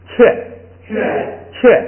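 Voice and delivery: teacher leads the class reading 雀; model reading 雀. Shown as a demonstration. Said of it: "咱们来读啊".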